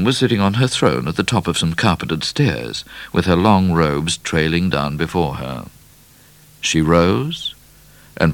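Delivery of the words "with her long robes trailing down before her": usual speaking voice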